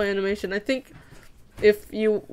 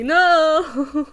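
mock shout; 6 semitones higher, 4 dB louder than usual. Refuses being misquoted.

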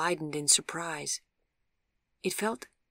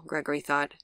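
plain reading voice

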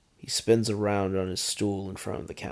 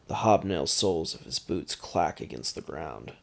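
ordinary delivery, nothing marked

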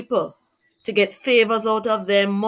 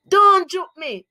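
loud protest; 9 semitones higher, 4 dB louder than usual. Shown as a demonstration. Child protesting being poked.

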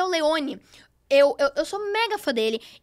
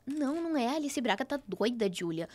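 dismissive retort; 2 semitones lower, 7 dB quieter than usual. Retold or imitated. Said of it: "ela virou lá".

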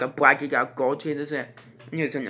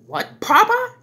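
1 shocked; 6 dB louder, slower than usual. Retold or imitated. Reacting in shock to the creature.

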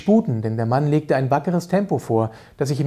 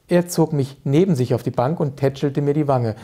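usual speaking voice

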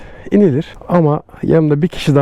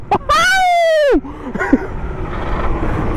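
shrieking burst of laughter. Real, not acted. Thrilled by scooter ride.

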